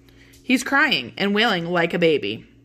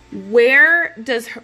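loud, slow, higher-pitched demand; acted desperate demand; 4 semitones higher, 8 dB louder, much slower than usual. Romeo with dagger out demanding of the friar, desperate. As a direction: lean hard and long on each word.

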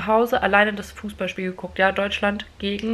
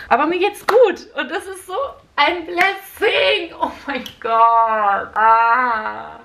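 excited; 5 semitones higher, 4 dB louder, slower than usual. Real, not acted. Gushing about feeling good.

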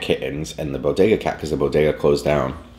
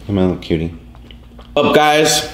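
calling the cats; 4 semitones higher than usual. Real, not acted.

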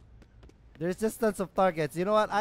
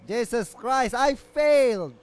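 exasperated shout; 5 semitones higher, 7 dB louder, slower than usual. Real, not acted. Exclaims over failed mission.

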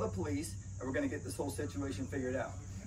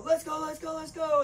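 much higher, urgent call of "Let's go"; calling the dog; 12 semitones higher than usual. Retold or imitated. Handler retelling a drill, calling his dog to come, urgent high call. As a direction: call the dog over across a field.

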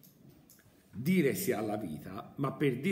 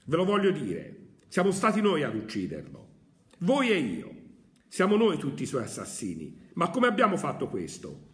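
louder, urgent prophetic outcry; impassioned madman; 2 semitones higher, 3 dB louder than usual. Acted. Madman confronts the crowd, loud and urgent. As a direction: hurl the accusation at the crowd. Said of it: "Il folle uomo balzò in mezzo a loro".

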